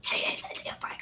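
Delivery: whispered cartoon character impression; hushed comic parroting; Fire Marshal Bill. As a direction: squeeze the impression out in a soft, voiceless rasp. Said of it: "you sound like the Jim Carrey character".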